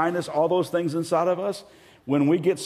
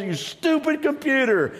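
mock anger at couch; 9 semitones higher than usual. Preacher mocking anger at a couch. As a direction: scold the couch in a silly high voice.

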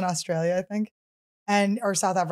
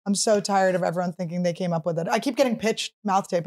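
ordinary talk

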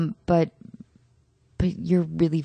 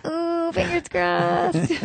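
high and slow; 13 semitones higher, much slower than usual. Acted. Mock hopeful swoon.

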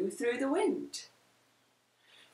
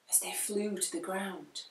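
ordinary delivery, nothing marked

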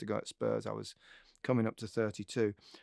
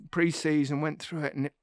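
usual speaking voice

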